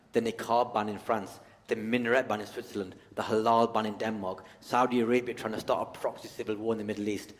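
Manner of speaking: ordinary talk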